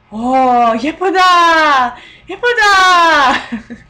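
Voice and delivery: high, loud delighted gushing; exaggerated excited praise; gushing ajumma. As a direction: coo over her like a delighted Korean auntie. Ajummas complimenting her, acted.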